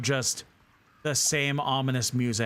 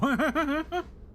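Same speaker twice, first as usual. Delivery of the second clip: high laugh. Real, not acted. Laughing at the quiz.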